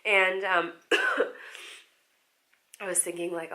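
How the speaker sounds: ordinary delivery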